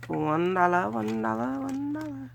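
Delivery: rising, drawn sing-song "one dollar" chant; street vendor's call. Retold or imitated. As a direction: call out prices like a market seller. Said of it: "that's how they say it".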